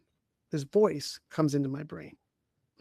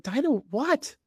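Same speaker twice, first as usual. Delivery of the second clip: much higher, puzzled rising question; baffled question; 9 semitones higher, slower than usual. Retold or imitated. Man answering his inner voice, startled and higher. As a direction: toss it back in disbelief.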